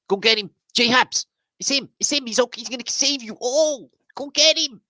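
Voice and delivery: high, loud hammed-up alarm; mock mob cry. Crowd hunting the man who is the cure, mock frantic shouting. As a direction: shout like a pretend posse spotting its man.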